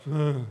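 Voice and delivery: low, wordless grudging grunt; imitated sulky kid's grunt. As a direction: grunt instead of apologizing. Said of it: "did you just grunt".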